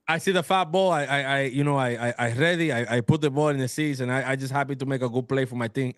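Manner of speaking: put-on lightly accented player answer; a hint of a player with broken English; mock broken English. Joking reply in a postgame interview bit, light broken-English accent. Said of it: "How do you feel about the game today?".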